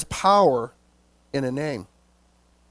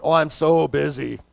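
mock busy person; 6 semitones lower than usual. Mimicking people answering how they are doing, mock weary. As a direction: imitate someone weary with their own busyness.

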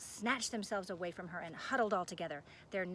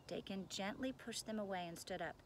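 ordinary delivery

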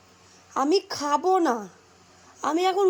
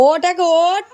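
loud wake-up call; 3 semitones higher, 4 dB louder, slower than usual. Real, not acted. Mother waking her daughter, loud call. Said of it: "সকালবেলা রোজ আমার এরকম চেঁচাতে হয়".

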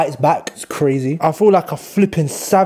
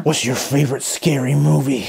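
put-on horror villain voice; a sinister phone-killer's question; Scream killer voice; 3 semitones lower than usual. Masked killer to victim in Scream, menacing voice.

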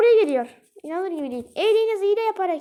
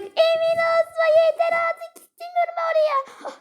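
whimpering refusal; 9 semitones higher than usual. Real, not acted. Scared of the graveyard level.

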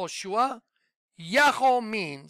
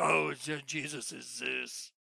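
mocking the video people; 5 semitones lower, 7 dB quieter than usual. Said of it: "the people that do whole video".

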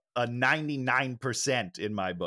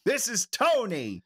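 slow, high-pitched upbeat sign-off; stretched, raised-pitch introduction; going up; 8 semitones higher, slower than usual. Shown as a demonstration. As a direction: lift the pitch high on the name. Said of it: "I'm trying to go up with it".

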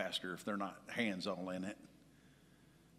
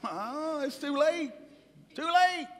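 much higher and louder, building whine; mock whine; 9 dB louder, slower than usual. Husband sulking about the late dinner, rising mock wail. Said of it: "I'm pouting".

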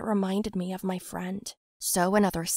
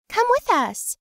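Gina pleading; 13 semitones higher, 6 dB louder, slower than usual. Acted. Scared Gina pleading, voiced.

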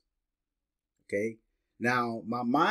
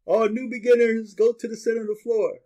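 DJ announcer voice; 10 semitones higher, 5 dB louder than usual. Retold or imitated. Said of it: "I heard the guy on, the D J was saying".

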